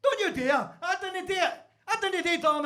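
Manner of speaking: high-pitched mock protest; acted squealing denial; indignant denial. Accused man answers back in story.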